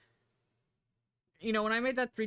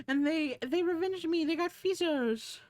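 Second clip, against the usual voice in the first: high-pitched doting voice; cutesy gushing; 9 semitones higher than usual. Real, not acted. Streamer gushing about the dog's owner, cutesy gushing. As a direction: gush high, stretching the last words.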